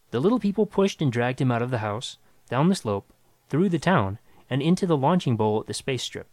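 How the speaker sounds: ordinary delivery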